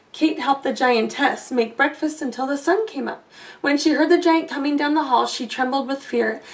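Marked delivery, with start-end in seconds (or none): none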